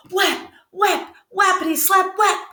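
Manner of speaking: high, bouncy "whap, whap"; playful slap sounds. Acted. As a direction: chant the slaps playfully. Narrator voices slapping sounds.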